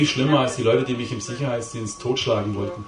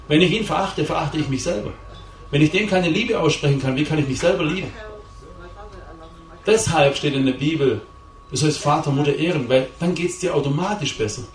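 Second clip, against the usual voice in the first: impassioned preaching; 5 semitones higher than usual. Acted. Preacher urging his congregation to honour their parents, impassioned. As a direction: preach it hard to the room.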